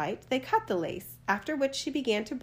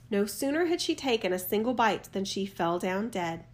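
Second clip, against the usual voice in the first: ordinary delivery, nothing marked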